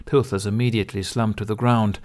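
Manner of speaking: plain reading voice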